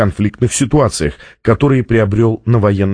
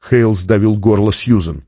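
usual speaking voice

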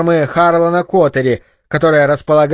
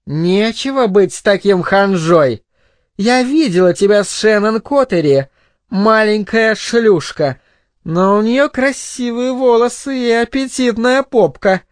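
high-pitched woman, crudely teasing; tipsy goading mother; 5 semitones higher than usual. Acted. Drunk mother taunts her son about a girl, teasing. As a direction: goad the boy in a raised woman's voice.

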